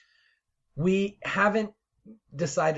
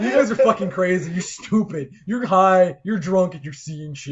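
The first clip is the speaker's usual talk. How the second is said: a hint of an exasperated Jesus, louder and higher; mock Jesus telling believers off, laughing; Jesus scolding; 5 semitones higher, 9 dB louder, slower than usual. Co-host imagines Jesus mocking the story, mock scolding. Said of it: "Jesus being like".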